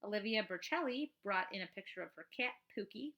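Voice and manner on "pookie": usual speaking voice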